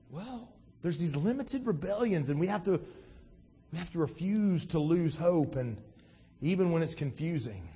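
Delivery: ordinary talk, nothing marked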